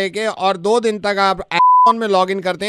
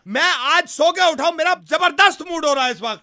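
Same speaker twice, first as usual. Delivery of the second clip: heated complaint at raised pitch; argumentative tone; 4 semitones higher than usual. Acted. Suitor insists he is in a great mood, argumentatively. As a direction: claim to be happy while sounding cross. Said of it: "tone है ये".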